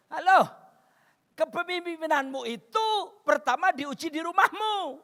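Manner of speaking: high, loud preaching; emphatic exhortation. Real, not acted. Preacher challenges husbands about leadership, emphatic exhortation. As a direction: call out, then proclaim it forcefully. Preacher drives point at congregation.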